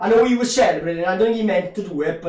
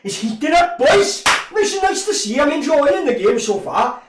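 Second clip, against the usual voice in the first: much higher, bright with delight; excited cheer; 8 semitones higher, 4 dB louder than usual. Real, not acted. Fan telling his viewers the game is heating up, excited.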